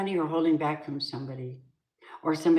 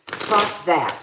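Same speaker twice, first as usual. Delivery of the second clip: louder curt fuck that; angry dismissal; 6 dB louder than usual. Real, not acted. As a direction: spit out "Fuck that" loud, with the stress on "Fuck". Angry refusal mid-argument.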